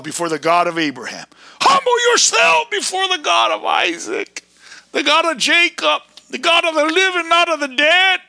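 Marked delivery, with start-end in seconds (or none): 1.6-4.4 fiery sermon shout; preaching shout. Real, not acted. 4.9-8.2 high, loud preacher's proclamation; fervent sermon shout; preaching at full force. Real, not acted.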